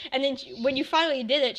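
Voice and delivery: ordinary talk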